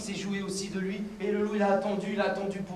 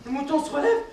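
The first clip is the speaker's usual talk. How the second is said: ordinary delivery, nothing marked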